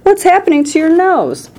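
high, alarmed question; alarmed shriek. A narrator's reading. Mother stares at daughter's nose, high alarmed shriek. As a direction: shriek it high without much volume. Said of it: "shrieked Missus Beauregard".

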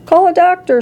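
high, urgent call; shout. A narrator's reading. Father calls for a doctor, high urgent shout. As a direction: shout it high, volume contained. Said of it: "shouted Mister Beauregard".

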